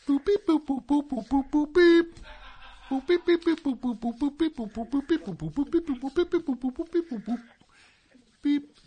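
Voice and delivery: high mouthed beep-boop sounds; mouthed keypad beeps. Pretend. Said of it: "And I'm drawing right now".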